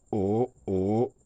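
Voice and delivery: O rising, then O dipping and rising; two long gliding O sounds; second and third tones. Shown as a demonstration. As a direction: bend O upward, then dip and lift it. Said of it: "le deuxième".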